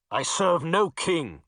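slow, menacing growl; growling knight. Acted. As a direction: growl the words at him. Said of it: "the knight growled".

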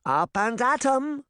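high, slow cheery wake-up call; cheerful cry. A narrator's reading. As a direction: rouse them with gusto. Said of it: "Granny cried".

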